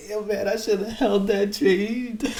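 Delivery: high, slow mock regret; regretful trader. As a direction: whine it like a kicked trader. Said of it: "You be like".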